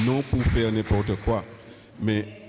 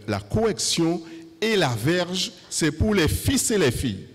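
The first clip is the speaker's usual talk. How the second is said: preaching with fervor; 6 semitones higher than usual. Acted. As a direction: build the sermon to a climax. Preacher rising to full force.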